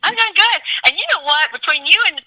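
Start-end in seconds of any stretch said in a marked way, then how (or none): 0.0-0.6 bubbly reply. Real, not acted. Cheerful reply to greeting.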